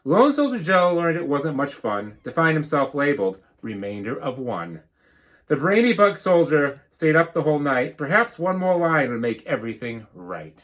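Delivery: lively storybook read-aloud; bouncy rhyming storybook narration; reading to children. Acted. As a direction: read it like a picture book to the class. Teacher reads picture book.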